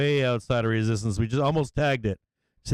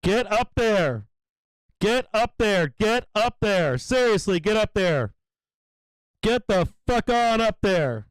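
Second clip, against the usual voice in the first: will the price up, shouting at the screen; repeated high cheering command; urging shout; 6 semitones higher, slower than usual. Real, not acted.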